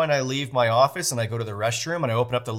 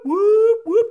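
very high, loud siren whoop; imitated alarm whoop; 7 dB louder than usual. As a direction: mimic a shrill alarm siren. Imitating the alarm waking everyone.

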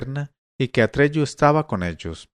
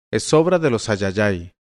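usual speaking voice

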